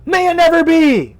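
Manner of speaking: high, loud, hammed-up formal voice; mock stuffy voice. Shown as a demonstration. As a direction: send up how nobody talks. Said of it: "be like".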